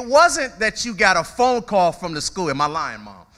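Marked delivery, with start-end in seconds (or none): none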